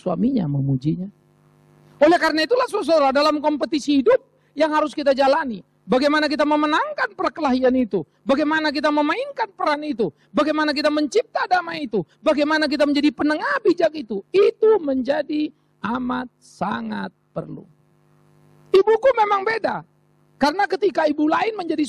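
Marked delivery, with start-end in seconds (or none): 2.0-17.6 fervent pulpit build, slowing at the end; preaching at full force. Acted. Preacher builds rhetorical series. 18.7-19.9 proud exclamation. Acted. Proud exclamation about mother.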